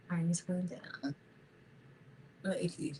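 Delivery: breathy whispered aside, voice dropped away. Real, not acted. Vlogger whispering to herself while working, very quiet. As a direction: hush it to almost nothing.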